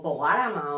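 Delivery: "pogárama" said with the r dropped and an extra a, po-GA-ra-ma; "pogárama" as a vulgarism, r swallowed after p; vulgar mispronunciation. Shown as a demonstration. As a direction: mangle "pogárama" like a careless speaker, stress on GA. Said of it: "en vez de".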